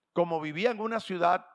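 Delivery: ordinary talk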